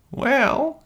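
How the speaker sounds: put-on high thin old bear voice; old Nan. A narrator's reading. Old Nan answering the king, high and thin. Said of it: "said Old Nan".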